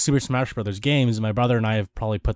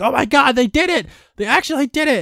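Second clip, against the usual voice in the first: high, loud imitation of ecstatic fans; hyped-up fan freakout, much higher; mock excited screaming; 13 semitones higher, 5 dB louder than usual. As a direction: squeal it like fans losing their minds.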